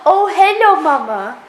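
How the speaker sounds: high, loud cutesy pet voice; bunny greeting his owner, high sweet pet voice; voicing the bunny. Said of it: "he's like".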